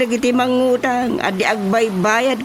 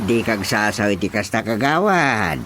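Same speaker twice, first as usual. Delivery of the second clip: calming admonition; 10 semitones lower than usual. Acted. Chairman calming the councillor.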